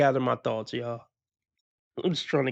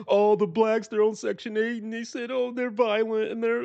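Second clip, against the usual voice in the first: mocking voice; 8 semitones higher than usual. Mocking a guest's views.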